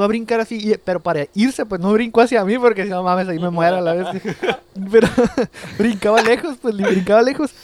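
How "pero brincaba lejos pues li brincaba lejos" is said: words breaking into a laugh. Real, not acted. Telling friends about the spider, laughing. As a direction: laugh through it, still amazed.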